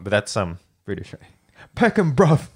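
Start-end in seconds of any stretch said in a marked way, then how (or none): 1.7-2.5 put-on quick London street accent; light London roadman accent. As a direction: toss it off like a Peckham local. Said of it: "I just like putting the accent".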